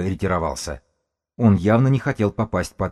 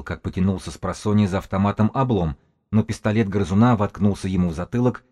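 plain reading voice

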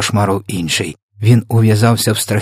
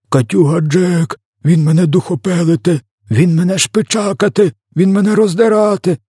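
high, panicked lament climbing higher; wailing; 10 semitones higher, 3 dB louder than usual. A narrator's reading. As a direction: wail each accusation like a giant being tortured. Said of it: "лементував тілогриз". (пронизливий лемент, дедалі вищий)

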